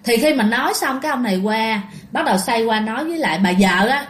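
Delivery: plain reading voice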